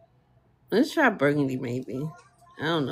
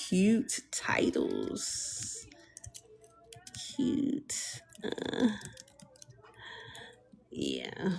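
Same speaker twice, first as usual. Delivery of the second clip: whisper; 5 semitones higher, 11 dB quieter, much slower than usual. Real, not acted. Whispers a prompt to the GPT.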